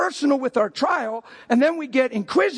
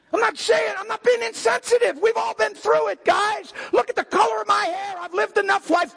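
full-force preaching; 8 semitones higher than usual. Acted. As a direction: preach it out to the whole room.

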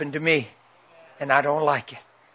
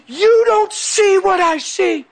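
slow, very high imitated yell; screaming; 14 semitones higher, 5 dB louder, slower than usual. Overwhelmed son screaming at his dad, imitated drawn-out yell. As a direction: stretch out the son's desperate shout. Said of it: "screaming at me".

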